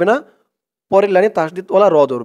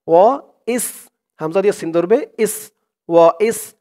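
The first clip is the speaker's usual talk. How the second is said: ordinary talk, nothing marked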